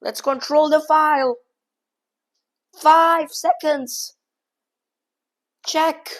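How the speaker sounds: high, loud, racing calls as the clock runs out; frantic excitement. Real, not acted. Streamer racing the opponent's last seconds, frantically excited. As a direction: shout every move as seconds tick away. Time scramble, flagging the opponent.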